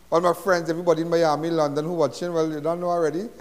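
ordinary talk

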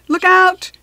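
high, loud warning shout. A narrator's reading. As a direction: yell it across the classroom. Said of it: "George shouted".

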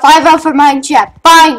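ordinary talk

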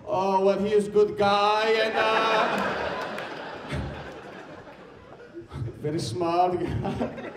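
put-on slow, broken-English old lady voice; lightly sung, halting grandmother voice; grandmother impression. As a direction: drawl it out like an immigrant grandma. Comic voicing grandmother, accented.

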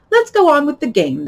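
high, loud Queen voice; imperious Queen. Acted. Queen ordering Alice back, imperious.

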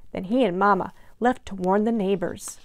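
plain reading voice

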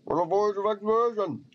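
high-pitched, put-on Viking voice; hammed-up Viking. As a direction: exclaim as a hammy game Viking. Voicing a Viking game character.

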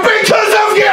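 loud, high accusing blast at the Brexit voters; mock accusing shout. Comedian pretending to yell at Brexit-voting passengers, mock accusing shout. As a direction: point and yell it at them. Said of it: "not to go up to them and go".

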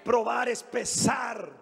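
high, fired-up pulpit preaching; rally the church from the pulpit; preaching at full force. Acted. Pastor rousing the congregation with fiery preaching.

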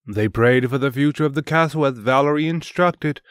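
usual speaking voice

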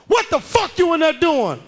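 high, loud furious mom; angry mother. Retold or imitated.

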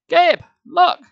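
very high, very loud alarm; urgent cry. A narrator's reading. Sari grabs Gabe's arm and cries out. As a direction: cry out sharply to make him look. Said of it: "she cried".

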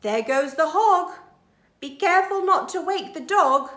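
thief voice. Acted. Thief speaking.

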